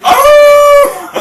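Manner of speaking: loud high gasp of shock; startled cry. Acted. Acting the shootout scene, loud startled cry. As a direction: react as if shot at.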